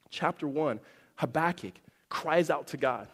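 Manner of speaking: ordinary talk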